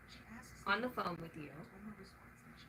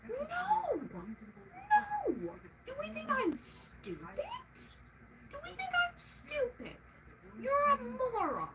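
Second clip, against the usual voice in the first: screaming at the guy who messed up; furious shout; 13 semitones higher than usual. Real, not acted. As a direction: scream at him in outrage. Shouting at him.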